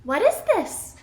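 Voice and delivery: high, curious storybook voice; amazed character question; wondering question. A narrator's reading. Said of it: "she asks".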